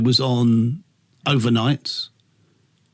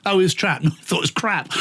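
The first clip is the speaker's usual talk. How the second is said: normal talking voice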